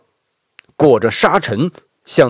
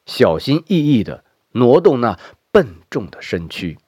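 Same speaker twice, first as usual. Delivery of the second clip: plain reading voice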